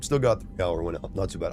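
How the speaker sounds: normal talking voice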